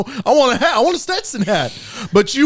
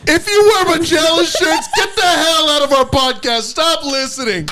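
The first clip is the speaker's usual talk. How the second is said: higher, hammed-up rant against shirt wearers; mock rant; 5 semitones higher than usual. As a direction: play it as a half-joking tirade, pitched up.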